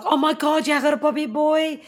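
high, loud gushing exclamation; excited auntie. Retold or imitated. Auntie at the birth exclaiming over the baby boy, high and excited. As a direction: imitate an overjoyed aunt crying out at a newborn.

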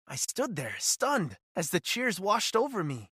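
ordinary delivery

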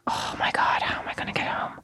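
breathless panicked whisper. Retold or imitated. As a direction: whisper it as a private thought of panic. Retold panicked inner thought.

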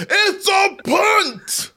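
very high, drawn-out announcer yell; Gus Johnson. Retold or imitated. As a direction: stretch the call into an overblown play-by-play cry. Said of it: "Gus Johnson with the".

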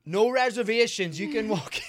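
imitating a hostess loudly over-enunciating. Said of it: "they get louder?".

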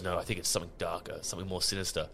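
low, soft brooding murmur; lightly voiced ominous Batman; a hint of a grim warning, hushed. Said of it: "Batman goes".

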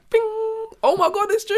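high excited squeal. Pretend. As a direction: burst out thrilled, very high. Imagined excited reaction.

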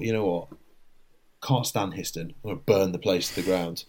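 low, soft aside to yourself; quietly vowed revenge, lightly retold; private muttered thought. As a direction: drop right down, as if thinking aloud. Retelling own vengeful private thought.

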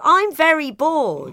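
put-on high, loud, slow bored child; child saying it aloud. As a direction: blurt it like a bored kid at dinner. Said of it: "they'll go".

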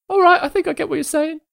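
high, chirpy little creature voice; squeaky fey creature. Acted. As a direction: play a sweet tiny fey critter. Turnip creature speaking.

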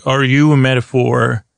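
ordinary delivery

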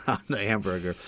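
ordinary talk

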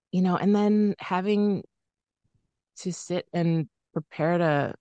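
fighting tears; teary. Real, not acted. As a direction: voice tight with held-back tears. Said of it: "getting all teary thinking about this".

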